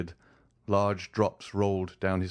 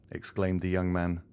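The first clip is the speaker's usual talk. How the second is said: ordinary delivery, nothing marked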